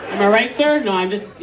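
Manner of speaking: ordinary talk, nothing marked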